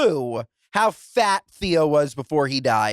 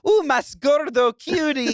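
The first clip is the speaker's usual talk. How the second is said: cooing Spanish; 8 semitones higher than usual. Retold or imitated. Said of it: "or saying".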